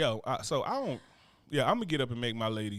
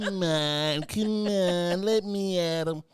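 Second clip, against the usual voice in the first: higher, louder, slow mock fight taunt; hammed-up scrapper; 6 semitones higher, 5 dB louder, much slower than usual. Husband voicing Bunny wanting to fight, hammed-up taunt. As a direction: play a tiny fighter held back, itching to brawl. Bunny choosing violence, voiced.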